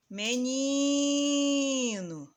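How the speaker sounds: "Menino" called loud, very long "ni"; calling out the word. Shown as a demonstration. Teacher showing which syllable lasts longer, "Menino" called out with "ni" drawn out. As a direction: call "Menino" as if hailing someone, holding "ni". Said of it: "pronunciar como se estivesse chamando a palavra".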